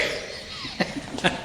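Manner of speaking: short unvoiced laugh; a quick breathy chuckle. Real, not acted. Laughs after emcee call.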